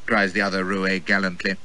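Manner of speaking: ordinary delivery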